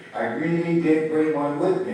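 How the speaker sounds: put-on boy angrily insisting to grandfather; angry insistence. A narrator's reading. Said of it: "the boy said angrily".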